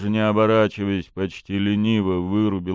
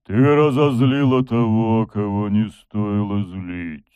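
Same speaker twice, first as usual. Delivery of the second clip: slow, menacing drawn-out threat; demon voice; 5 dB louder, slower than usual. A narrator's reading. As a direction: stretch the words with menace. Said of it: "Это был голос демона".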